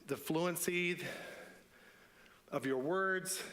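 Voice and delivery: ordinary talk, nothing marked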